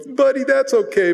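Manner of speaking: high, friendly and light; kindly storekeeper. Retold or imitated. Storekeeper waving off a boy's apology, friendly. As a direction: brush it off like a nice shopkeeper. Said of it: "The storekeeper goes".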